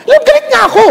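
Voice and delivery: put-on high, childish sulk with a laugh; sulky protest. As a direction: pout and whine that you are angry. Husband sulking to God.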